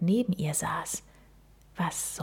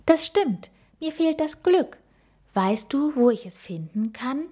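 put-on higher voice of a hopeful little gorilla girl; young gorilla girl; 6 semitones higher than usual. A narrator's reading. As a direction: ask the owl higher, eager and a little shy. Gorilla girl Frieda speaks.

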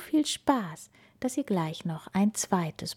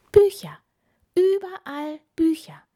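very high, excited squeak; little mouse marvelling aloud; amazed mouse; 10 semitones higher, 7 dB louder than usual. A narrator's reading. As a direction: gasp it out high, in wonder. Mouse amazed at books everywhere.

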